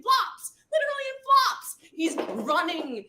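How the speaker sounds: loud, high-pitched emphatic storytelling; amazed exclamation. Real, not acted. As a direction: exclaim it in disbelief, stretching the words. Amazed at guide running in flip-flops.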